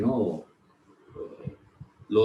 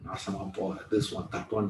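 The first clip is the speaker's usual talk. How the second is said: muttered mock scolding; 2 semitones lower, 7 dB quieter than usual. Old lady scolding to herself at midnight on the train, mock muttered scolding. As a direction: imitate someone scolding quietly to herself.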